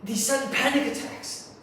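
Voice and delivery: high, loud, mounting distress; panicked rant. Acted. Sleepless person confessing panic attacks to the listener, panicked.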